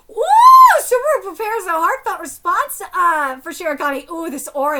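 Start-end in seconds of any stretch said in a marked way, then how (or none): 0.1-0.8 high, loud "ooh" of excitement; thrilled shouted ooh; excited whoop. Real, not acted. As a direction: whoop sharply at the page. Thrilled reaction to the manga.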